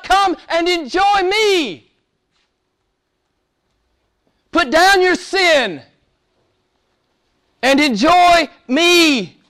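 high, slow, ringing preacher's cry; impassioned preaching. Real, not acted. Preacher urging the congregation to enjoy God, impassioned and high. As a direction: preach at full force, drawing out the key words.

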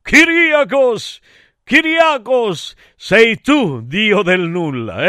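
high, loud, slow proclamation; theatrical goddess's high call; Athena calling out. Acted. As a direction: call the name out grandly, drawing it out. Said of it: "E Atena mi disse".

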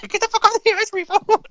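very high squeaky voice. Acted. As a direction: squeak it like a cartoon mouse. Repeats the mock line.

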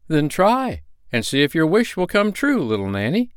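high, emphatic urging; commanding. A narrator's reading. Little man orders the girl to try walking, commanding. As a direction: give a spirited, kindly order. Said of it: "commanded the little brown man".

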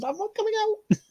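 squeaky high taunting voice; high-pitched cheeky refusal; silly goose voice. Pretend.

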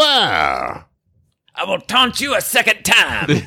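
very slow, high mock-French taunt; Monty Python Frenchman. Quoting a comedy's taunting Frenchman, mock French accent. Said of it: "I like that guy".

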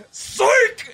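very high mock shout; gleeful yell. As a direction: yell it like springing a prank. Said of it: "And then I'd yell".

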